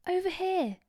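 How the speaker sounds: high, bright ride-guide call; Hermione voice. Pretend. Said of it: "whether it's Hermione or whether it's Chloe".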